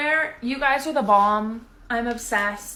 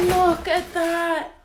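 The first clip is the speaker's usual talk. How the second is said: excited; 5 semitones higher, 4 dB louder, slower than usual. Real, not acted. Showing pink shoes.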